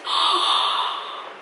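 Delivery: sharp unvoiced gasp of surprise; surprised gasp. Real, not acted. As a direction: catch breath in sudden alarm. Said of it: "I've been talking for ten minutes".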